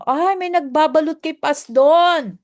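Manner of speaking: loud, slow surprised exclaim. Real, not acted. Surprised exclamation about food wrap.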